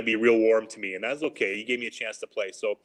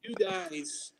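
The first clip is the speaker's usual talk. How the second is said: mock yell; 6 semitones higher than usual. Coach telling off a rookie behind the door, mock yell. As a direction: imitate a coach's yell with a held-back voice. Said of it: "he's trying to yell at me".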